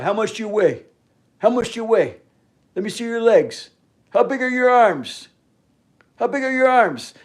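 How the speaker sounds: high, loud mock questioning; mocking impersonation. Grown man quizzing others on their size, mocking and high-pitched. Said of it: "when he started talking like that".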